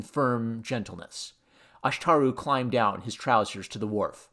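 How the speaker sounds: usual speaking voice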